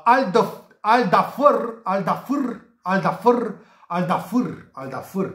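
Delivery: "Al Dafr" ending in a short vowel-r, no final a; attempted correct pronunciation. Shown as a demonstration. Tipster tries out the club name, "Dafr" as "DA-fur". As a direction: try "Al Dafr" over and over, each ending rising. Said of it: "cred că așa s-ar pronunța corect".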